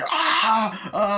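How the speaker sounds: high, loud "ah" of a stabbing; hammed-up death cry; mock victim cry. Film fan acting out the stabbing, mock cry.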